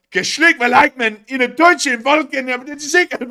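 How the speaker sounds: high, loud, slow mock German gibberish as the frog puppet; Kermit voice. Host reads a viewer's fake German in a hammed-up Kermit voice. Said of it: "says Kermit".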